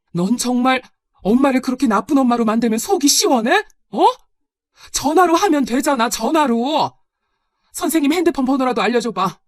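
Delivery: loud, high-pitched angry retort; exasperated outburst. Acted. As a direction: argue back, fed up and heated. Mother snaps back in quarrel. (언성을 높여 쏘아붙이는 말투)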